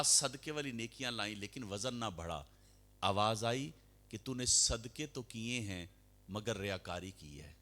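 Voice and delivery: ordinary delivery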